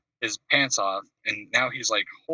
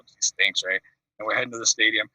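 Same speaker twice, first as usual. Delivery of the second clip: normal talking voice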